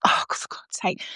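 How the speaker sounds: imitated exasperated whisper; exasperated choirmaster. Choirmaster after singers muck it up, exasperated whisper. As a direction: whisper it under the breath, fed up. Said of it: "and he's like".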